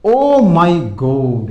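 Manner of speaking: very slow and loud; slow teacher demo; slow model exclamation. Shown as a demonstration. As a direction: present it word by word. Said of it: "फिर देखो".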